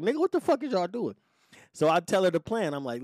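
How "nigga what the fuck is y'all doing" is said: put-on high, disbelieving demand from the girlfriend; a hint of the girl catching them red-handed; mock outraged question; incredulous girlfriend. Friend's girl catching them packing.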